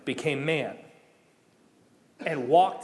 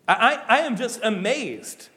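preaching; 5 semitones higher, slower than usual. Acted. Preaching at full force.

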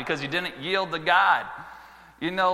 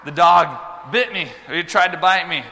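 ordinary talk, nothing marked

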